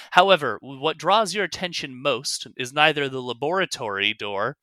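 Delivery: normal talking voice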